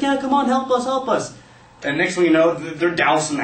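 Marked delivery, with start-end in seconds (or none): 0.0-1.3 high, urgent acted cries for help; desperate plea. Kids hitting windows at firefighters, desperate. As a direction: call out high and pleading.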